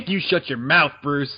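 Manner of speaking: laughing, loud mock telling-off; mock scolding. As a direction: scold him through a laugh.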